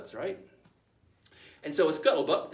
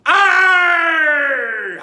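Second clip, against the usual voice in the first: very long, high, full-force "arr"; massed pirate roar; 13 semitones higher, 17 dB louder than usual. Acted. Crew all going "arr" together, roared at full voice. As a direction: bellow it like a whole pirate crew. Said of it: "and they go".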